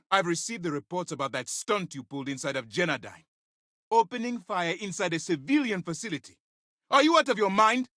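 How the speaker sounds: high, incredulous scolding building to outrage; appalled reprimand. Acted. Commander on reports of firing inside a civilian facility, outraged. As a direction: start tense and high, climb into disbelief. Officer rebuking reckless stunt.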